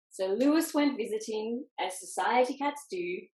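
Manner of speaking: ordinary delivery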